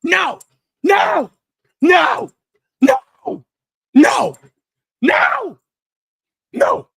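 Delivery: high, loud screamed no's; tantrum screams. Acted. Cohost acting out Seth's tantrum on live TV, screaming. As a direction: throw a full tantrum. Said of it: "biggest temper tantrum".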